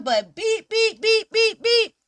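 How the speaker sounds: honking her own horn, teasing; mock horn beeps. Tooting her own horn for the mugs, playful beeps. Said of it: "toot my own horn".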